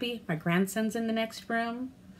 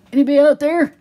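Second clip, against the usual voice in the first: "Anybody out there?" called out much louder and higher; calling out; 5 semitones higher, 14 dB louder, faster than usual. Shown as a demonstration. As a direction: shout "Anybody out there?" into the void.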